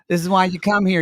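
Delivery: usual speaking voice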